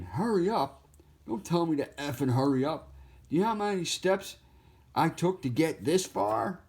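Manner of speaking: high, exasperated cartoon turtle; indignant turtle. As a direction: sputter back at being rushed. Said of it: "have him saying".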